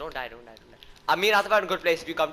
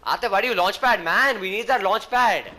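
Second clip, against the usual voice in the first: annoyed scolding; 5 semitones higher, 5 dB louder, slower than usual. Real, not acted. Scolding teammate.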